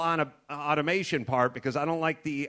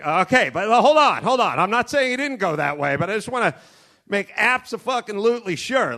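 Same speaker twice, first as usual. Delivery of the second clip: louder and higher, pleading fast; frantic sidekick; 4 semitones higher, 6 dB louder than usual. Pretend. Cowboy sidekick frantically arguing with the tracker. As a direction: panicky pushback, half laughing.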